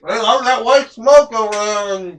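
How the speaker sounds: talking through a mouthful of food; mouth full. Real, not acted. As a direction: say it while chewing. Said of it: "talking with your mouth full".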